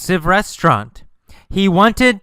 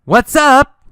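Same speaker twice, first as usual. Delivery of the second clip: high, loud shouted greeting; yelled; 10 semitones higher, 6 dB louder than usual. Acted. Teacher retelling Carlos's call to the girl, yelled. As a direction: yell the greeting, pitched well up. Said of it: "After he yelled".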